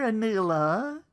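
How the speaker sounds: usual speaking voice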